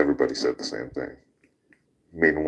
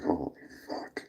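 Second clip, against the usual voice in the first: hushed, breathy aside to a friend; urgent under-the-breath curse; whispered warning; 11 dB quieter than usual. Retold or imitated. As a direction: whisper it so the cop can't hear. Warning friend while cops listen.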